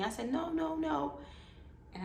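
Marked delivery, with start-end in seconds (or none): 0.3-1.2 high, drawn repeated refusal; insistent protest. Retold or imitated. Patient doubting the saline answer, insistent. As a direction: push back against the answer.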